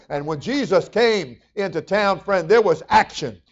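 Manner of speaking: loud, high-pitched full-force sermon; fiery preaching. Acted. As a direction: preach it hard to the congregation.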